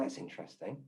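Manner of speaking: breathy aside to herself; quiet inner thought; whispered thought. Retold or imitated. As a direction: mutter it under the breath, barely voiced. Private thought after Pat's message.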